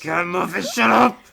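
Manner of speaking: loud mock yell; shouting. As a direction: yell it at an annoying friend. Joking rant about Muffin.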